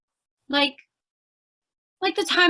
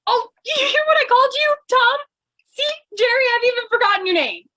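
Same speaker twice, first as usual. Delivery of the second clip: nervous chatter; 10 semitones higher, 4 dB louder, faster than usual. Acted. Nervously pretends to forget his name.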